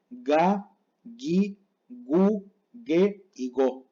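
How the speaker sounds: "ga, gi, gu, ge y go" all with g as in gato; spaced syllables, hard g even in "gi" and "ge"; Japanese hard G. Shown as a demonstration. Teacher reads G syllables, "ga, gi, gu, ge y go" all hard. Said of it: "La letra G siempre se pronuncia".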